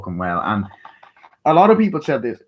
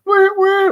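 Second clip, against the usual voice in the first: very high, loud train toot; shrill sustained toot-toot imitation; Thomas the Tank Engine whistle; 8 dB louder than usual. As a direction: imitate a toy train whistle in two blasts. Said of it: "hear the Thomas the Tank Engine whistle".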